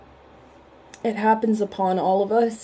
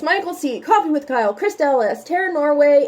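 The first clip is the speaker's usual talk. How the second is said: normal talking voice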